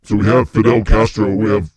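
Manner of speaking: a big demon proudly touring hell; low loud demon. Pretend. Second demon shows off hell.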